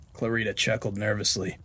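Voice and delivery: ordinary delivery, nothing marked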